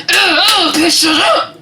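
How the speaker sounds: high, loud exasperated outburst; growing fed up, yell it over groans; pissed off. Retold or imitated. Woman in labor sick of voices, pissed off. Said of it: "it was pissing me off so fucking bad".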